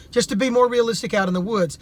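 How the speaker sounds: ordinary delivery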